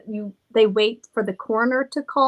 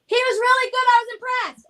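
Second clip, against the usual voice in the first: high, loud, proclaimed praise; calling out a compliment; 13 semitones higher, 3 dB louder than usual. Real, not acted. Praising son loudly so he can hear, calling out. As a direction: announce it so the absent one hears. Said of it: "I want him to hear me give a compliment".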